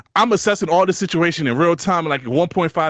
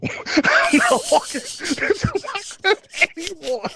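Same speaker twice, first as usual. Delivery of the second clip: higher, slow, laughing through the words; slower than usual. Real, not acted. Telling his friend he took an Uber, laughing. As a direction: let the laugh break up the line.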